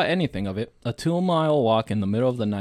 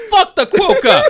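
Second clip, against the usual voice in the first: high laughing yell; laughing shout; 9 dB louder than usual. Real, not acted. Outburst at the animal.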